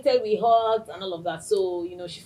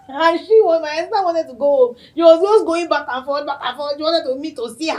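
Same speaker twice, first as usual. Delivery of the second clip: laughing while telling it, higher and louder; 8 semitones higher, 11 dB louder than usual. Real, not acted. Host laughing while recalling her impatient assistant. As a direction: giggle through the story.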